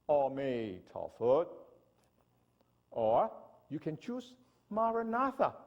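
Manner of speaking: ordinary talk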